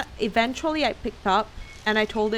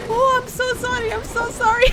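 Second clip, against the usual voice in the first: imitating a caller turned apologetic, higher and louder; sudden apology; 4 dB louder than usual. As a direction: gush out a quick mock sorry. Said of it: "He changed tone immediately".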